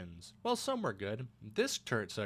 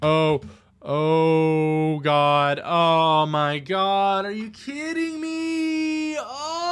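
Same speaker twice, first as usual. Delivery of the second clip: high, loud cry of despair; anguished yell; 5 semitones higher, 14 dB louder, much slower than usual. Real, not acted. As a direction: wail it in real despair. Dies in game, despair.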